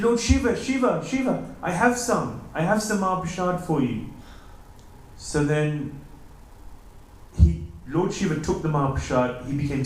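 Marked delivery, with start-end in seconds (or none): 0.0-4.1 high, loud eager call; excited calling out. Acted. Narad calls out to the raging Shiva, high, loud and thrilled. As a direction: call up to him, thrilled to have found some.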